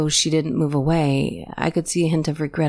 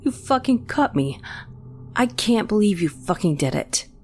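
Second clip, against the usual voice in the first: pained shock; 6 semitones higher than usual. A narrator's reading. Brother just wounded by knife.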